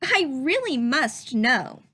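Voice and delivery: lively, curious rising questions; questioning read-aloud voice. Shown as a demonstration. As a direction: read each question like a wondering child. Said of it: "notice how I change my voice when I read a question".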